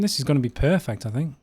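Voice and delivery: ordinary talk, nothing marked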